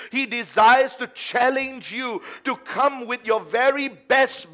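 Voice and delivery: loud, slow, rising preacher climax; preaching at full force. Acted. Preacher urging the beloved to give their best, full force. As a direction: lift it up and drive each line home.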